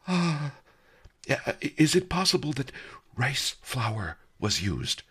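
halting, deferential query; contrite. A narrator's reading. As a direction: broach the suspicion gingerly and apologetically. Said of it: "the merchant said contritely".

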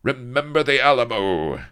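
loud, high rallying cry; battle cry. A narrator's reading. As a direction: shout it as you charge in. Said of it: "with a cry of".

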